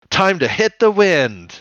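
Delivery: high, slow cheesy catchphrase; corny hero line. Retold or imitated. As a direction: ham up a corny battle cry. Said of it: "She says some corny shit".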